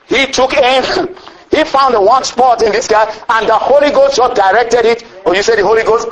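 ordinary talk

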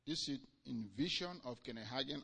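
ordinary talk, nothing marked

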